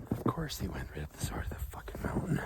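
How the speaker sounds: low, exasperated whisper. Real, not acted. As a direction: grumble it under your breath. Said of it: "repeated aloud after whisper".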